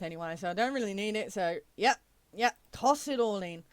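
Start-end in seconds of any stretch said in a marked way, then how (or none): none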